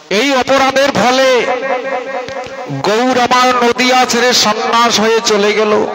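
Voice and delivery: high, sustained acted lament; tearful lament. Haridas blaming himself for Gour leaving Nadia, acted lament. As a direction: cry it out high as a preacher's acted grief. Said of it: "কাঁদছে আর বলছে".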